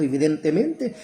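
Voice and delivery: ordinary talk, nothing marked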